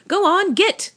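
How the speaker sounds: high, loud shout to scare a bird off; boy yelling at a crow. Acted. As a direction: shoo the crow away, loud. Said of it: "flapped his arms and shouted".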